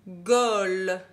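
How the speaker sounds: "Gaulle" dicté très fort; dictée appuyée. Shown as a demonstration. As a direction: lance "Gaulle" fort et net. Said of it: "On entend le L à la fin". (mot dicté d'une voix forte)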